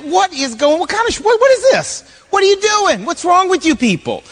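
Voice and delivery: high, stammering acted incredulity; splutter, voice shooting up in bewilderment; baffled disbelief. Young David seeing the army run away, acted spluttering disbelief.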